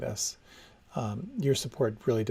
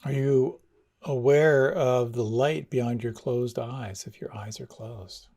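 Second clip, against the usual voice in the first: guided meditation. Acted. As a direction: ease them inward, unhurried. Leads a meditation.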